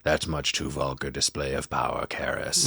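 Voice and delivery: a half-serious devil looking down on the priest, pitched low; mock demon voice. The possessed girl mocking Karras's challenge in a low demon voice. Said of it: "And she's like".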